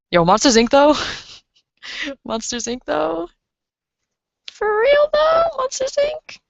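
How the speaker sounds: climbing into a very high squeaky falsetto; playful falsetto riff. Pretend. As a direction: start normal, laugh, then go sky-high in mock disbelief.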